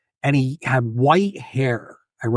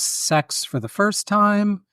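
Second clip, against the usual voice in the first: high, slow, drawn-out mock question; teasing mom; 7 semitones higher, much slower than usual. Mom ribbing her son about his first time, slow teasing question. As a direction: tease him, stretching each word.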